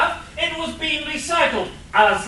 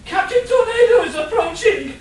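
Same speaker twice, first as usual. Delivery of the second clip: higher, louder, urgent with a laugh in it; alarmed announcement; 10 semitones higher, 7 dB louder than usual. Acted. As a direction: blurt out the alarm half-laughing. Henchman reports hero's arrival.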